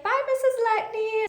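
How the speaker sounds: high, singsong bye; cheery student goodbye. Retold or imitated. Student leaving class with a high cheery bye. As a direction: chirp it out as a kid. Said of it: "she's like".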